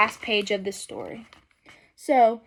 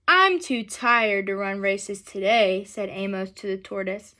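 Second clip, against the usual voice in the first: gentle, unhurried storybook reading; reading to children; 2 semitones lower than usual. A narrator's reading. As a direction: read it aloud to a class of kids.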